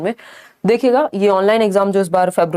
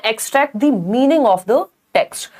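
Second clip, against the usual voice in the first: slow, careful option read; reading the option aloud; 2 semitones higher, much slower than usual. Shown as a demonstration. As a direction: spell out the option slowly. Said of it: "C option है". (धीमे से विकल्प पढ़ना)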